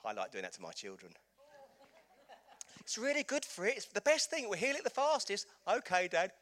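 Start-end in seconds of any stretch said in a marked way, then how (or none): 2.8-5.5 high, loud teasing dad voice, hammed up; mock know-it-all dad. Dad to his child, hammed-up cheerful insistence. 5.7-6.3 a child's voice, put on high and loud; mock trusting child. Child agreeing with dad, mock trusting.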